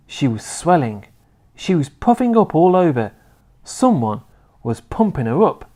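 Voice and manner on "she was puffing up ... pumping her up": usual speaking voice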